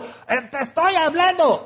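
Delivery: high, strained booming call; thundering God. Acted. God thundering at the listener, high booming call. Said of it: "los truenos de Dios y la voz de Dios".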